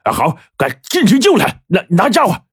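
high, clipped urgent orders; hurried boss. A narrator's reading. Boss tells his brother-in-law to grab weapons, hurried. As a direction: bark out orders quickly.

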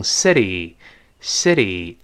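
"city" as "siddy", T softened to a tap; American "city" with voiced flapped T. Shown as a demonstration. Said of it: "这个t会浊化".

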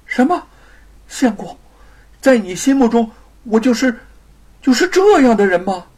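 high, loud hurt disbelief; shocked. Acted. Wife hears husband's accusation, shocked and hurt. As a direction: cry out, stung by his words. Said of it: "玉凤愕然了".

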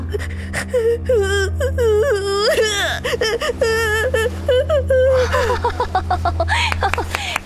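unhinged shrieking laughter; crazed cackle; manic high laugh. Acted. Manic laugh after screaming.

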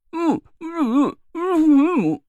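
high, tearful closed-mouth whines; whimpering hums. Acted. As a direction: whine in protest, lips sealed. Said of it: "呜啦哇啦地朝我吹胡子瞪眼儿".

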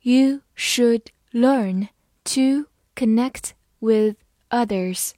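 word by word, clear pauses between words; slow read-along. Shown as a demonstration. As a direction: read it slowly for learners to follow. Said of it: "好，跟我慢读一遍".

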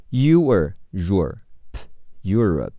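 Europe said slowly, syllable by syllable; slow clear demo. Shown as a demonstration. Teacher modeling Europe for students, slow and clear. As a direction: spell out Europe slowly for learners. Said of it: "Europe，p是p".

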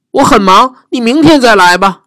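high, hammed-up dragon boasting; a greedy dragon showing off.

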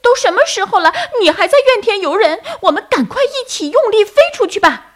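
very high, loud, fast bird voice; anxious turtledove. A narrator's reading. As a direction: urge them on in a frantic squeak. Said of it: "斑鸠着急地说".

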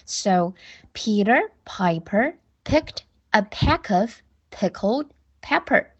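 slow, careful full read of the twister; slow demonstration. Shown as a demonstration. Teacher demonstrating the full twister for pupils, slow. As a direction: model every word deliberately. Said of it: "teacher models tongue twister".

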